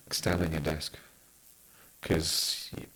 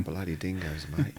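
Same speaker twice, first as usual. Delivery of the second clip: mock Australian accent; slower than usual. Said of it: "And in Australia".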